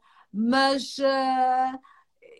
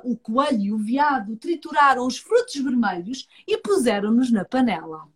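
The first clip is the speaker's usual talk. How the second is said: plain reading voice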